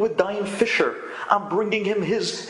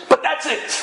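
sudden high, loud warning; father warning son it's final; stern shout; 10 semitones higher, 4 dB louder than usual. Retold or imitated. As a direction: snap back to firm. Father setting firm limit.

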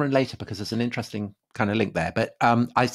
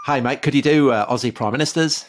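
put-on Australian accent; 3 semitones higher, 4 dB louder than usual. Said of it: "didn't say it in that accent".

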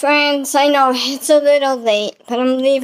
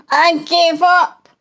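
mock despair; 4 semitones higher, slower than usual. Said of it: "I just wanna cry and go".

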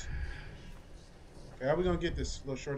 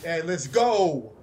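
slow, louder drawn-out cheer; victory shout; 8 dB louder, much slower than usual. Real, not acted. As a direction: stretch out a loud victory yell, slowly. Victory over enemy.

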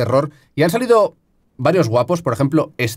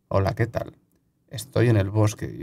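hushed; 6 semitones lower, 7 dB quieter than usual. Real, not acted. Speaking softly to stay silent.

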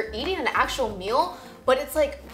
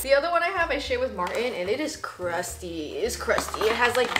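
ordinary talk

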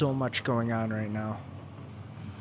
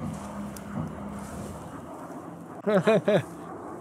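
long laugh; 7 semitones higher than usual. Real, not acted. Laughs at the game.

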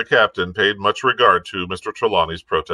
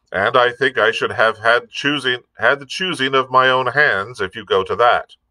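lightly voiced blunt sea captain, somewhat higher; Captain Smollett; 6 semitones higher, 4 dB louder than usual. A narrator's reading. Captain airing a grievance to the doctor, lightly voiced and firm. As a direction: lightly play a firm, plain-spoken captain.

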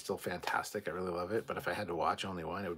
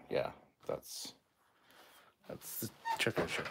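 usual speaking voice

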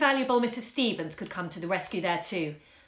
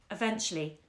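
ordinary delivery, nothing marked

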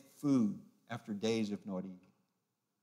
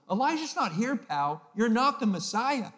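much higher, louder scornful taunt; mocking jeer; 9 semitones higher, 6 dB louder than usual. Bystanders taunting Jesus as he dies, scornful higher jeer. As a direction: throw the scorn up at him. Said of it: "They're mocking Jesus going".

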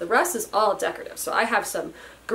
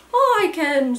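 ghost voice; 7 semitones higher, 6 dB louder than usual. Acted. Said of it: "said the ghost".

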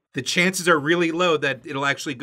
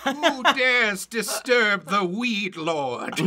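slow, higher villain voice with laughter; ancient rasping undead king; 5 semitones higher, much slower than usual. Acted. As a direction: intone it like a cursed old king. Booming villain voice parody.

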